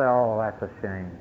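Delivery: low, soft moaning "Oh, that's a shame"; mock moan. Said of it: "moan and say".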